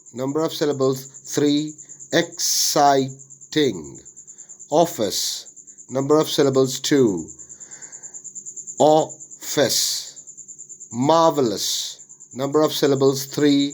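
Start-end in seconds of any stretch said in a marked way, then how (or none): 2.1-4.0 "exciting" slowly split as ex, sigh, ting; syllable by syllable. Shown as a demonstration. Teacher dividing worksheet words, "exciting" broken into syllables. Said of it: "syllable division of the words". 8.8-10.9 "office" split as off, fess with a gap; syllable by syllable. Shown as a demonstration. Teacher dividing worksheet words, "office" broken into syllables. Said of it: "syllable division of the words".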